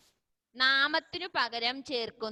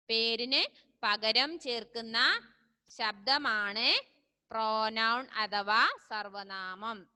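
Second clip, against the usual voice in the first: slow dictation; 2 semitones lower, slower than usual. Shown as a demonstration. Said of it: "teacher dictates definition slowly".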